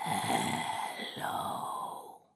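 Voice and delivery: faint, breathless voice from beyond; ghostly whisper. A narrator's reading. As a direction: let it drift from far away. Ghostly voice on telephone.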